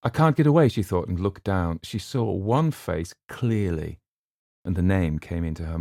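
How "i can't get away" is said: high, loud panicked cry. A narrator's reading. Trapped on the platform.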